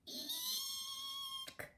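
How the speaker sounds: very high thin squeal; squealing eek. A narrator's reading. The bear meeting the giant bee, very high squeal. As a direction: squeal in terror. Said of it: "squealed the bear".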